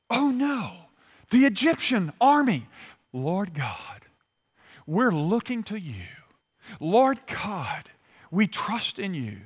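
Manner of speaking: hushed, earnest plea to God, slowly; imagined prayer of humble trust. Pretend. Israelites praying to God as the army nears, trusting. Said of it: "I wish I could say when it says they cried out".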